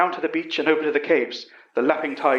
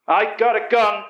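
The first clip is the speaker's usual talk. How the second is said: slow, higher, menacing warning; 6 semitones higher, 4 dB louder than usual. A narrator's reading. Hostage-taker warning the professor off, slow mild threat. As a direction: make the threat land slowly. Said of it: "Utterson warned".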